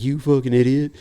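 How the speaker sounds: high-pitched mock insult; imitating people chewing you out; retold berating. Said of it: "getting stopped and fucking berated".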